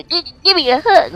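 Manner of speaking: the attacking dog pleading for a hug in squeaky voice; cutesy dog voice. Retold or imitated. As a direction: beg like a needy little dog. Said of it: "he hops at me".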